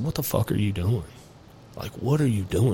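a man at his mirror, quietly scolding himself; self rebuke. Retold or imitated. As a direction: ask yourself in quiet disgust. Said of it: "I literally said out loud to myself".

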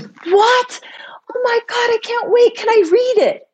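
high, breathless whispered excitement; excited ex-husband. Retold or imitated. Ex-husband hearing the book exists, whispered high excitement. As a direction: gush like a thrilled kid, whispering high.

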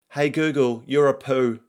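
put-on cheeky kid taunting a gadget; young daughter. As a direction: call it out high like a small child. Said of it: "says my daughter Ida".

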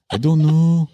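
high put-on reluctant voice; Rudy. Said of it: "She's like Rudy from Bad Friends".

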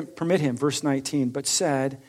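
usual speaking voice

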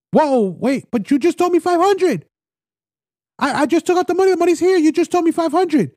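high-pitched mock outrage, protesting the price hike; indignant buyer.

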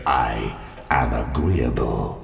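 low, slow gin-soaked stranger; low looming threat. A narrator's reading.

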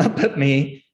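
ordinary delivery

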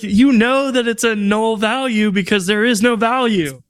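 high-pitched retold rant; exasperated. Acts out exasperated rant at API.

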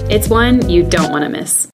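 ordinary talk, nothing marked

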